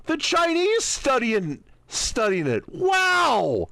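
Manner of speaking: mock amazement. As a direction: play fake astonishment to mock the book. Sarcastic mock amazement at conspiracy book.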